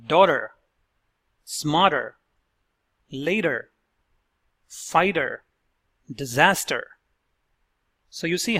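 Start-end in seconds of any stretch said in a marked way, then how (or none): 0.1-6.9 "Daughter", "smarter", "later", "fighter", "disaster" with T as a quick D; one word at a time, clearly modeled; flapped T model words. Shown as a demonstration. Coach demonstrating the fast D in -ter words. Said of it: "Let's hear the words".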